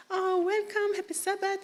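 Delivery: high-pitched, effusive greeting; imitated gushing lady at the door; welcoming church lady. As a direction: greet a newcomer in a high, eager voice.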